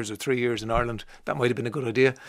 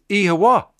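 Alexa saying Ihawa; 6 semitones higher than usual. Retold or imitated. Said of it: "she replies".

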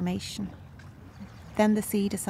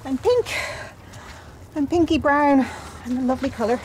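excited; 5 semitones higher, 4 dB louder than usual. Real, not acted. Just out of cold sea.

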